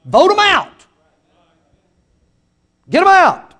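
high, loud pulpit cry; shouted command. Real, not acted. Preacher shouting at voters to remove politicians, high and loud. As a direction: punch each word out.

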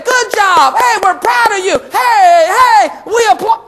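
high, loud acted applause; cheering praise. Preacher voicing mom and dad praising a child, high cheering. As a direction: cheer them on like a proud parent.

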